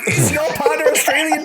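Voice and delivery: squeaky incredulous question, laughing; mock disbelief. Retelling a stranger's mix-up, laughing high disbelief. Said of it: "And I'm like".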